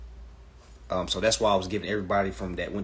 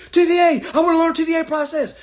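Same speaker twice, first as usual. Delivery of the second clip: much higher, loud whiny mimic of a lost beginner; mocking clueless newbie; 7 dB louder than usual. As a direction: squeak it out like a confused newcomer. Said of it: "Folks be so lost with the".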